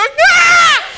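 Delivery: high loud mock scream of fright; hammed-up frightened yell; startled scream. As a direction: shriek like you've seen a ghost. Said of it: "the disciples go".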